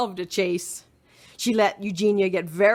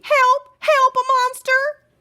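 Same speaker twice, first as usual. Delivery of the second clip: high, loud panicked cry; frightened shout; 7 dB louder, slower than usual. Acted. Baby screaming for help at a monster. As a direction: shriek for help. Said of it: "shouted Baby".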